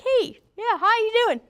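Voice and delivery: high, loud mock greeting from a clueless coworker. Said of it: "dumb Dee Dee goes like".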